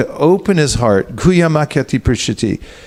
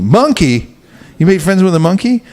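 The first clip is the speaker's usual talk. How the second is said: she can't believe her husband's friend is a monkey; shocked wife; 5 semitones higher than usual. Retold or imitated. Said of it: "He goes".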